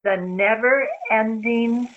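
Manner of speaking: drawn out slowly; slow intoned chant; slow mantra. Acted. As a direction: stretch each word for her to echo. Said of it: "I'll say it very slowly".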